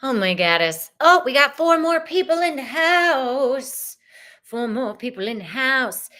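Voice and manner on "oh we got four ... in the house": high, loud singsong whoop; excited announcement. Real, not acted. Host hails four new chat arrivals, excited singsong. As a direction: announce it big, stretching the last word.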